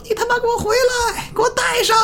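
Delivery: very high, strained mock shout; yelling after someone. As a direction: imitate a frantic far-off yell at someone running away. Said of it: "老王还跟后边喊呢".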